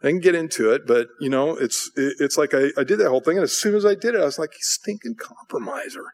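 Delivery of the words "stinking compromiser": slow, soft, disgusted aside; hushed self-reproach. Retold or imitated. Believer berating himself after giving in, hushed self-reproach. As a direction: scold yourself under your breath.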